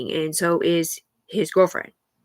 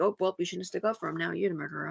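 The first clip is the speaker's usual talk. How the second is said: dismissive quiet accusation; 3 semitones lower, 10 dB quieter, faster than usual. Retold or imitated. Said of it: "And like".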